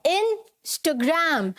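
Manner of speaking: Instagram very slowly as "in... stuh-gram"; corrected pronunciation. Shown as a demonstration. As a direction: pause after IN in Instagram, then short "stuh-gram". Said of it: "ये जो एच की sound आती है".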